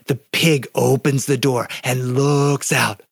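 slow suspenseful telling, "looks out" stretched, then silence; hushed-tension storytelling, lingering on "looks out"; dramatic pause. Shown as a demonstration. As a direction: draw out "opens the door and looks out" and stop dead. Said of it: "silence or a dramatic pause to great effect".

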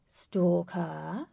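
loud, theatrical stalker, preening over his dog; smug villain. A narrator's reading. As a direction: ham up the gloating villain. Voices the villain Lutz.

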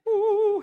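loud, high-pitched "ooh"; playful falsetto whoop. Real, not acted. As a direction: let out a sudden high "ooh". Sudden playful whoop at the audience.

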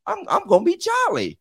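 high, lively churchy voice; excited preaching. Retold or imitated. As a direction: build up like you're getting happy in church. Retelling getting happy in church.

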